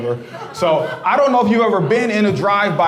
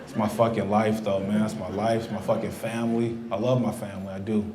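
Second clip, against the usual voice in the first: mock sincere; 8 semitones lower, 9 dB quieter than usual. Mock heartfelt family confession.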